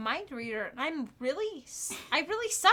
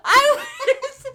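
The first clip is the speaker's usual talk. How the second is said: much higher, much louder laughing complaint; laughing rant; 8 semitones higher, 13 dB louder than usual. Real, not acted. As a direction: shriek it out while cracking up. Laughing rant about the hiccup.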